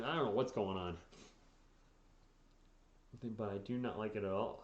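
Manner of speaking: ordinary delivery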